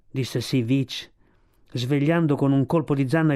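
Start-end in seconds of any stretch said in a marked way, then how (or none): none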